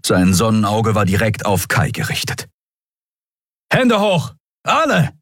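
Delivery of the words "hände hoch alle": high-pitched armed guard's command; urgent, high exclaimed command; barked threat. A narrator's reading. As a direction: bark orders at prisoners.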